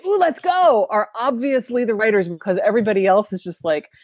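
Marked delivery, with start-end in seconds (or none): 0.1-0.9 high, keen "ooh, let's go"; acted-out excitement, pitched up; eager enthusiasm. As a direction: jump at the invitation. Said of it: "the two people who say".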